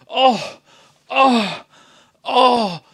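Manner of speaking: loud, high swooning exclamations; hammed-up rapturous ohs; mock gushing. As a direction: send up an adoring fan, loud and high. Mimicking people gushing over Fassbender.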